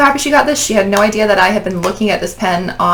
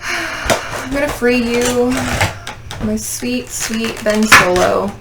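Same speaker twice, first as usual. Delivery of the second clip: tender; 4 semitones higher, slower than usual. Pretend. Fan freeing her Ben Solo figure, tender coo. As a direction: croon to the toy like a baby.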